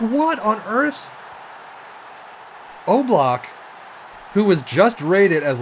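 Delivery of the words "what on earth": stunned disbelief. Real, not acted. Stunned by goalkeeper error.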